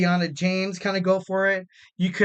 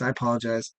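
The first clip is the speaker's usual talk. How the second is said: ordinary delivery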